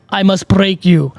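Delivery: slow, menacing mock-Russian accent; Ivan Drago Russian accent. Host quoting the film boxer, slow Russian accent. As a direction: intone it slowly with a Russian accent. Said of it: "real fucking Ivan Drago".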